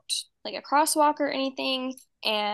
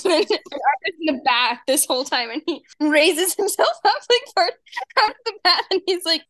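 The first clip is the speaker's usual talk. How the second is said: excited retelling; 7 semitones higher, 9 dB louder than usual. Real, not acted. Narration still in kid pitch.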